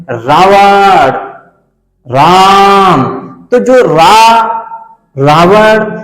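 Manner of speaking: long pluti vowel, called out; drawn-out call. Shown as a demonstration. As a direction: stretch the रा in रावण like a Ramlila announcer. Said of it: "ये जो खींच करके बोला जाता है".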